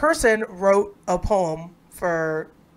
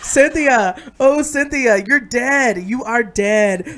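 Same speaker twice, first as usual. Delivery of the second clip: angry slam poetry; 4 semitones higher, 4 dB louder, slower than usual. Acted. Friend finishing the Cynthia poem, angry slam-poet lament. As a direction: wail it as a slam poet, then slow down. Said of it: "Yelling. Angry".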